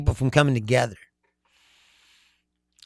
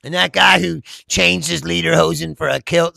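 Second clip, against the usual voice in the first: ordinary delivery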